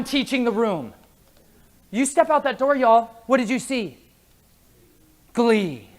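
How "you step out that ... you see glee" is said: ordinary talk, nothing marked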